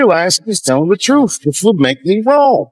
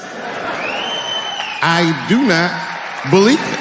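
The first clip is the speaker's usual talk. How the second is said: drawn-out disbelief; much slower than usual. Real, not acted. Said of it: "I don't believe these motherfuckers".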